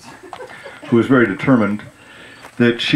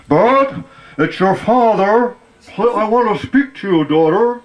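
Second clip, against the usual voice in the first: calling father; 9 semitones higher than usual. Retold or imitated.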